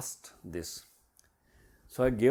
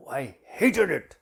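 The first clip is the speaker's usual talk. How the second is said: louder, slower, higher mock-angry complaint; angry retort; 4 semitones higher, 8 dB louder, slower than usual. Player answering coach about her hated assignment, angry. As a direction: act out an indignant player's grievance. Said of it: "she had a real angry wooden face".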